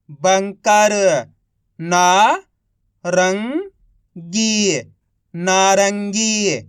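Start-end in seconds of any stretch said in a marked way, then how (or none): 1.8-4.8 each syllable drawn out very slowly; slow syllable-by-syllable reading. Shown as a demonstration. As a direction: sound out सुरंग slowly as सु-रं-ग, one sound at a time. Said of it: "एक अक्षर का उच्चारण करके".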